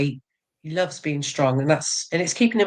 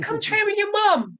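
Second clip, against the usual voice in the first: coaxing mum. Retold or imitated. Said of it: "I said".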